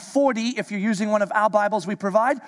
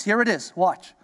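ordinary talk

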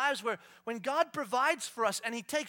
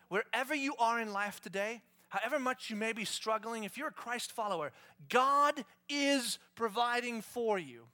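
usual speaking voice

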